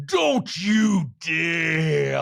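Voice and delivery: very slow, loud warning shout in a put-on voice; mock shout. Comedian acts out Meredith warning Whitney, shouting. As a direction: drag out every word as a threat. Acted quarrel recap.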